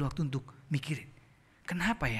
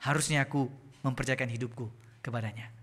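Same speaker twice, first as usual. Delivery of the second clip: ordinary delivery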